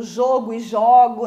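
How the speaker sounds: JOGO twice, closed O then open O. Shown as a demonstration. Teacher citing another homograph, contrasting closed and open jogo. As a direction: contrast the first vowel in jogo. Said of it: "Também é uma homógrafa".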